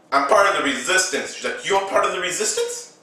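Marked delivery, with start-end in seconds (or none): 1.7-3.0 quick, high rising question; mock surprised echo. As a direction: echo it back in higher-pitched disbelief. Acts surprised echo of the claim.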